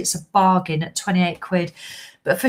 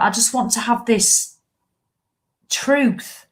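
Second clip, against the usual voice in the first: ordinary delivery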